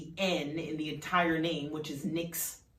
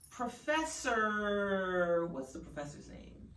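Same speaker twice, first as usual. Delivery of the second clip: ordinary delivery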